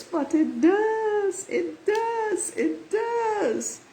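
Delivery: high, drawn-out does each time; slow, high-pitched gushing emphasis; gushing delight. Real, not acted. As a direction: revel in it, stretching every DOES up high. Gushing over the card's good news.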